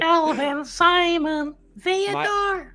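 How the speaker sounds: very high squeaky names; chipmunk voice. Pretend. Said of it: "devolved into radio D J".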